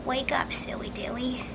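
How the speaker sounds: soft unvoiced whisper to the dog. Real, not acted. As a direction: breathe it into his ear.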